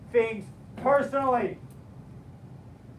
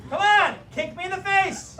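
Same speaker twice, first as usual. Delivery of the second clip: higher, louder mock command; impatient retold challenge; goading; 8 semitones higher, 6 dB louder than usual. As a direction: egg him on loudly. Instructor goads reluctant student.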